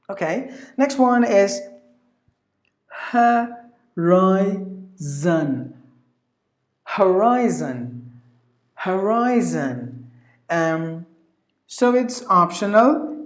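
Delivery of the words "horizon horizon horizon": HORIZON slowly as "huh, rye, zun"; careful syllables. Shown as a demonstration. Teacher introducing horizon to learners, slow syllable pronunciation. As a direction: sound out HORIZON, then join it, drawn out. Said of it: "Either you say it like three syllable".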